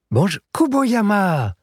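high, slow, delighted call; exclaiming a greeting. A narrator's reading. Koichi greets an old family friend, high excited call. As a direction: greet him with bright surprise, drawn out. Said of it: "s'exclama Koichi".

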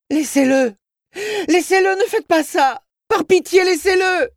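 mother's high, full-force shriek; horrible scream. Acted. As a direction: scream and beg for mercy at the top of your voice. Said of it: "Sa mère hurla, un hurlement horrible. Elle cria".

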